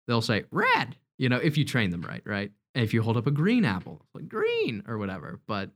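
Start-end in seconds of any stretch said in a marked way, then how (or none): 0.5-1.0 very high squawky parrot voice; parrot impression. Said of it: "that's my parrot impression". 4.3-4.8 very high squawky parrot voice; parrot impression. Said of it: "that's my parrot impression".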